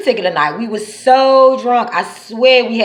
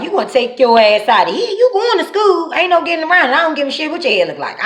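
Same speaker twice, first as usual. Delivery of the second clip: scolding mother; 6 semitones higher, faster than usual. Retold or imitated.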